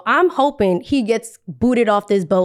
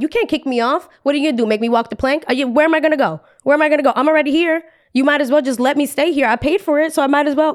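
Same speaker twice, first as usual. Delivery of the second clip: higher-pitched mock arguing; defiant protest; 6 semitones higher, faster than usual. Passenger daring the crew once the boat sails, defiant. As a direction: dig in with rapid-fire rhetorical questions.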